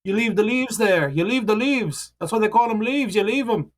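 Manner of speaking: put-on high old Danish lady, scolding and explaining; a fussy old Danish woman lecturing, pitched high; Danish accent. Old Danish lady lecturing the grave cleaner about leaves, in a high Danish accent. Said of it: "Doing my Danish accent".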